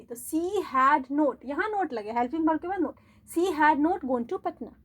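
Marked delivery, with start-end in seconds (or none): 0.2-1.4 slow, word by word dictation; teacher dictating at a crawl. Shown as a demonstration. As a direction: spell it out slowly for students to write. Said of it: "dictating slowly".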